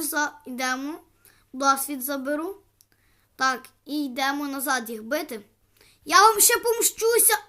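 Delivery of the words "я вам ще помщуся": higher, louder mock threat; playful threat. Real, not acted. Player threatening the slimes with mock revenge, loud.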